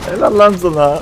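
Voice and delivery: high, loud relieved praise; excited cheer. Real, not acted. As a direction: shout your thanks in relief. Cheering ally's rescue. (sevinçle bağırarak)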